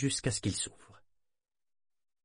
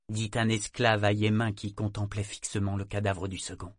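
usual speaking voice

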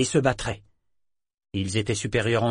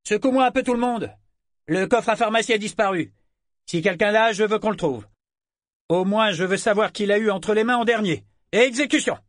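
high, raised commanding voice; barked orders; 10 semitones higher, 4 dB louder than usual. A narrator's reading. Lieutenant orders Cage to find the missing chest, barking. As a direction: order the crewman about, pitched well up. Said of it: "Brigg lui ordonna".

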